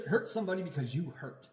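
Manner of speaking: ordinary delivery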